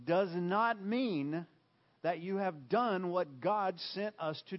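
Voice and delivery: each word hammered out slowly; slow emphatic stress. Real, not acted. Preacher pressing the congregation, slow and emphatic. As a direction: drive the point home word by word. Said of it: "preacher hammering the point".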